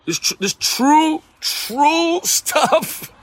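high-pitched, breaking into a laugh; amused insistence. Real, not acted. Pastor swearing the phone call story is true, laughing in disbelief. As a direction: insist on it through real laughter.

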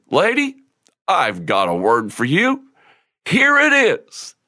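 mock-sincere announcement, pitch jumping high at either end; a little sarcastic. Minister answers the hungry lady, mildly sarcastic. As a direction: imitate a man grandly offering something, tongue in cheek. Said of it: "being a little sarcastic".

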